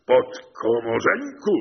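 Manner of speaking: loud, high, drawn-out call; defiant shout. A narrator's reading. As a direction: throw the mocking address out loud and slow. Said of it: "krzyknął".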